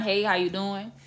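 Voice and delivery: just polite, nothing extra; calm, measured hello; toned-down kind greeting. Shown as a demonstration. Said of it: "just be kind".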